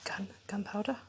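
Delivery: hushed prompting so only she hears; whispered prompt. Real, not acted. Mum whispering poem prompts to child.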